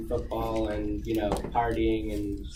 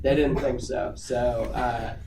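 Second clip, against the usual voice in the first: usual speaking voice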